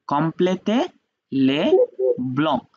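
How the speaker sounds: complétez, les, blancs each said apart with pauses; teacher separating complétez les blancs into words. Shown as a demonstration.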